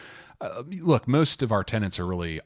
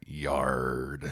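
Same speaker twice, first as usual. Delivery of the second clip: put-on pirate accent; 5 semitones lower than usual. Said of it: "like a pirate".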